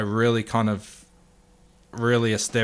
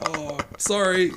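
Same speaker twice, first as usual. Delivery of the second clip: meek apology; 8 semitones higher, slower than usual. Retold or imitated. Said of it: "I'm standing there like".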